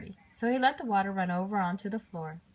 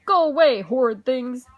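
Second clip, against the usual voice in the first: put-on loud, higher grumpy old man yelling; angry shout; 3 semitones higher, 9 dB louder than usual. A narrator's reading. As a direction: chase the children off. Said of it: "he would shout".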